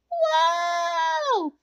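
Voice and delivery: high excited whoa on the ride; shouted whoa. A narrator's reading. Girls sledding down Nightmare Hill, shouting whoa. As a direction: cry out as the sled drops. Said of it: "the girls shouted".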